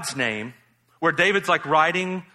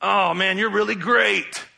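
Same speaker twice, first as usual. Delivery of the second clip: high, drawn-out mock complaint; hammed-up grievance at how great God is; Jonah; 6 semitones higher, 4 dB louder, slower than usual. Preacher mocking Jonah complaining to God, high and aggrieved. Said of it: "except for Jonah".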